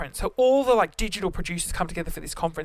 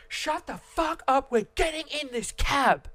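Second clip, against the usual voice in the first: retold yell; 5 semitones higher than usual. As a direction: re-enact snapping at a honking driver, high and drawn out. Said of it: "I'd yelled this".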